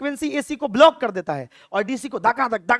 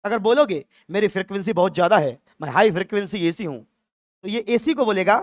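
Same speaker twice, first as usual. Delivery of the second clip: usual speaking voice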